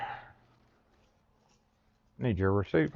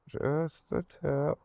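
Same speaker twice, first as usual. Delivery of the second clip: drawn out slowly, deadpan; slow joking innuendo; slow suggestive; 4 semitones higher, 5 dB quieter, much slower than usual. Real, not acted. Repeats phrase as a joke.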